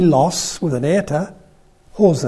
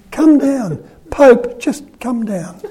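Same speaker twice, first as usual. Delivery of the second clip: playful call; 7 semitones higher, slower than usual. Retold or imitated. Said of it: "to any of us".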